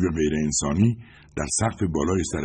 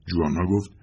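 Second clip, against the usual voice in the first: ordinary delivery, nothing marked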